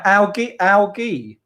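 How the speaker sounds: "algae" with a hard g, as AL-ghee. Shown as a demonstration. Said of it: "Fred is right".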